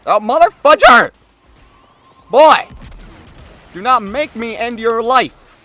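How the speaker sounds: high, loud game rage; angry yelling. Real, not acted. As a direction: yell at the enemies.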